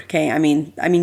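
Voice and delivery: ordinary talk, nothing marked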